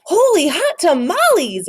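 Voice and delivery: high, loud excited cry; a boy shooting past his reflection exclaims; exclaiming. A narrator's reading. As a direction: burst out in delight. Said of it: "exclaimed Skippy John Jones".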